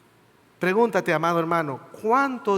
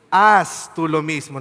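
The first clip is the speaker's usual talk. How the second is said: slow and louder, each word weighted; emphatic command; 7 dB louder, slower than usual. Retold or imitated. Preacher quoting Jesus to the congregation, slow emphatic command. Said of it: "Note el énfasis en la acción".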